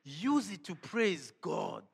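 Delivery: usual speaking voice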